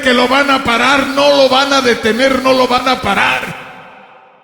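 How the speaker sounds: high-pitched, defiant sermon drive; preaching at full force. Real, not acted. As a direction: hammer the repeated phrases, stretching the endings.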